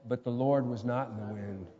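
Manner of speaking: quiet, low echo for the congregation; hushed repeat. Real, not acted. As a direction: let the verse sink almost to a murmur. Repeats verse after asking congregation.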